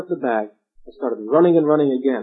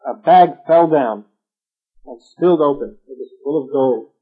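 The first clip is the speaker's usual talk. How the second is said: ordinary delivery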